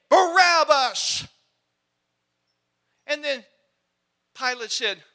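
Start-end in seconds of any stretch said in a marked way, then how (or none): 0.1-1.3 angry mob yell. Acted. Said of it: "an angry crowd, a mob, yelling his name".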